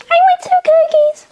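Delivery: put-on very high little kid voice; baby talk. Child ordering cookies at the drive-thru, high baby voice. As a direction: squeak it like a small child ordering. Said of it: "you think I'm understand baby talk".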